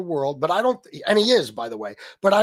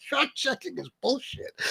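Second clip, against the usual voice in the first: higher, laughing repeat rising at the end; laughing disbelief; 8 semitones higher, 7 dB quieter than usual. Real, not acted. Repeating a customer's claim to viewers, laughing in disbelief.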